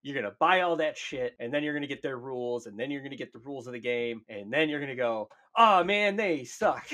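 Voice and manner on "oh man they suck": higher-pitched dismayed groan of regret; hammed-up letdown; mock disappointment. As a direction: let down after buying in, played for laughs. Imagined buyer's disappointed reaction.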